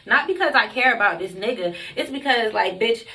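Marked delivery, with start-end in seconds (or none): none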